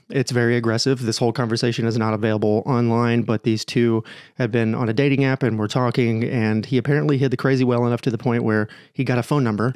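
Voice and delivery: ordinary talk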